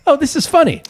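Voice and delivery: high, laughing delivery. Retold or imitated. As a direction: laugh through the words. Caller laughing, retold.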